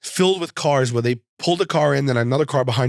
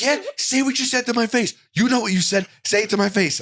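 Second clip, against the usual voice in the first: much higher mocking menacing demand; imitating wrestler's threat; 9 semitones higher than usual. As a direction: mimic the wrestler daring him to say it. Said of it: "He's like".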